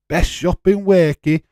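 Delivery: advert voice. Retold or imitated. Quoting a parody advert.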